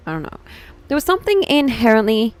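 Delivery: ordinary talk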